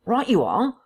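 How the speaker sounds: lightly voiced intruder, high-pitched assent; former husky voice. A narrator's reading. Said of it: "said the intruder in the former voice".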